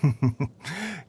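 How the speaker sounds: soft, quiet amused laugh; a brief gentle chuckle. Acted. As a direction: laugh lightly in agreement. Amused agreement.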